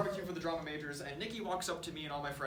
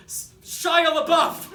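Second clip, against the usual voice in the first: stammered, then a loud, slow blurt of the name; panicked blurt; 10 semitones higher, 13 dB louder, much slower than usual. Retold or imitated. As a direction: blurt it out high and loud, cornered and desperate. Terrified student blurts out answer.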